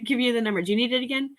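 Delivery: usual speaking voice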